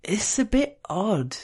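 slow, high-pitched slur; slurred. A narrator's reading. Narrator as patient helped down the station stairs, slurred. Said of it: "I slurred".

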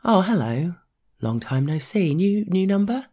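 bright, high, friendly phone greeting; cheerier tone. Acted. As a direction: switch to a cheery high hello. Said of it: "adopting a cheerier tone".

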